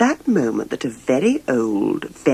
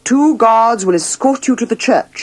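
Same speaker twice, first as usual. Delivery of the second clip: stern king; 6 semitones higher, 4 dB louder than usual. Acted. King orders princess to wedding.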